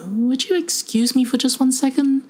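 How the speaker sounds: very high, tightly polite voice; alarmed but polite. Acted. Researcher escaping sinister stranger, politely panicked.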